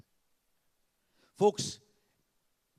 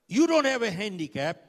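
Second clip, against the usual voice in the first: loud, raised-pitch scolding; retold shouting; 5 semitones higher, 10 dB louder than usual. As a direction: imitate the boss shouting a rebuke. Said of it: "He shouted at me".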